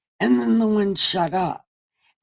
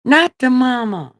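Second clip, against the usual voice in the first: louder and higher, punch it; loud mock outrage; loud punchline; 6 semitones higher, 6 dB louder than usual. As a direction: shout the punchline out. Jokes Jesus disowns mother.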